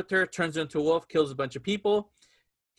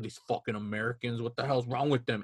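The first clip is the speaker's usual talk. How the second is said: lower, softer grumble with the puppet voice dropped; grumbling doctor; 6 semitones lower, 7 dB quieter than usual. Retold or imitated. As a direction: mutter it like a rude doctor behind his back. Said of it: "What happened to the voice?".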